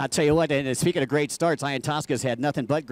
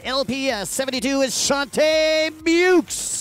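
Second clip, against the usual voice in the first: higher, slow, drawn-out rider introduction; announcer intro; 9 semitones higher, 3 dB louder, slower than usual. Real, not acted. Commentator introducing the last rider to the crowd, big drawn-out announcer call. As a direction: stretch the name out like a ring announcer.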